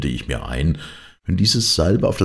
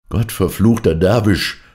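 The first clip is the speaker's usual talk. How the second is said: louder, higher narrated curse; cursing shout; 5 semitones higher, 6 dB louder than usual. A narrator's reading. Blinded man curses the dervish who warned him, loud shout. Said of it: "schrie ich jetzt".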